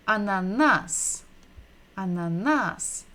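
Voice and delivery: ananas with the final syllable stressed; stress on the last syllable. Shown as a demonstration. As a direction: land the stress on the end of ananas. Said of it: "we are stressing the final syllable".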